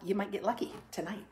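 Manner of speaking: usual speaking voice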